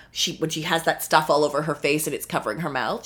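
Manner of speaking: ordinary delivery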